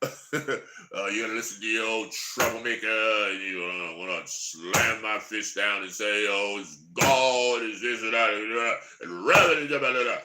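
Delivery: lightly lampoon a shouty preacher; drill sergeant preacher. Retold or imitated. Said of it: "the one that sounds like a drill sergeant".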